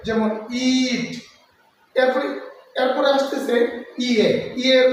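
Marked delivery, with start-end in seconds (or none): 0.7-1.1 ইট said slowly with a long drawn ee; long ee in eat. Shown as a demonstration. Teacher to students, demonstrating the long ee in ইট slowly. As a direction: stretch the vowel in ইট, slow and clear. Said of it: "দীর্ঘ উচ্চারণ করতে হবে".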